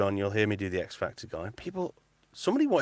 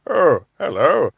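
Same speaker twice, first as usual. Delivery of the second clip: put-on very slow, louder stretched old gent voice; Patrick Moore impression; 4 semitones higher, 10 dB louder, much slower than usual. Shown as a demonstration. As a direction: draw it out like an old astronomer on TV. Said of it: "Patrick Moore".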